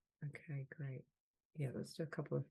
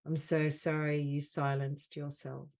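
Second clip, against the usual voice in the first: deliberate phrases, louder than the chat, long silences between; measured delivery, leaning on "so sorry"; guided healing affirmation; 7 dB louder, slower than usual. Acted. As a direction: offer each apology as a healing phrase. Leads healing phrases with long pauses.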